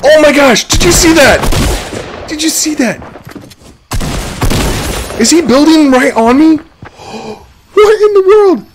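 high, loud panicked shrieks; panicking gamer. Real, not acted. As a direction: freak out as they close in. Enemies building right on him.

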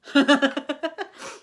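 loud, rising story-character laughter. A narrator's reading. Elmer laughs at the lion's grumble, out loud. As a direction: laugh out loud, high and hearty. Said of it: "Elmer laughed out loud".

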